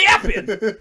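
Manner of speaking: "Happened" mimicked through a laugh; "Happened" copied while laughing; laughing mock echo. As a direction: laugh as you parrot it. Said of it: "Why do you say happened like that?".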